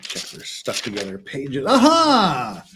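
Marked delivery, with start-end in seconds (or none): 1.6-2.6 excited. Real, not acted. Finds the missing step.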